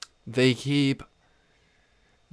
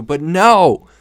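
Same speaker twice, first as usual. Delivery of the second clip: loud, higher burst of disbelief; exasperated shout; 6 semitones higher, 13 dB louder than usual. Real, not acted. Vlogger to viewers, shouting in exasperation. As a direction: throw it out loud: but no!. Indignant outburst at family's passivity.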